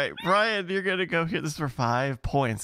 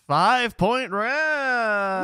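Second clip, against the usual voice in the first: drawn-out, booming game show reveal; big announcer build-up; 8 semitones higher, 4 dB louder, much slower than usual. Acted. Game host announcing the five-point final round, drawn out. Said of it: "game show host build-up".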